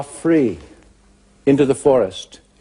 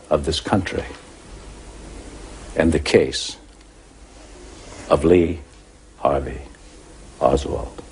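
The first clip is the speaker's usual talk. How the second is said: low, grave courtroom oratory with long pauses; hushed, weighty lawyer's close, dropping lower; solemn closing argument; 5 semitones lower, 4 dB quieter than usual. Real, not acted. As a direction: hand it to the jury phrase by phrase.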